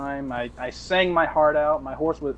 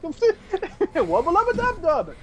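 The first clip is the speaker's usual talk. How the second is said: high, slow Wubba lubba dub dub in a mock New Zealand accent; Kiwi-accented catchphrase; 13 semitones higher, much slower than usual. Retelling his drunk catchphrase, slow mock New Zealand accent. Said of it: "in a New Zealand accent as well".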